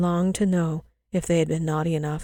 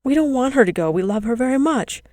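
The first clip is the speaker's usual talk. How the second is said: put-on despairing children; 6 semitones higher, 4 dB louder than usual. A narrator's reading. Children despairing, mother leaving.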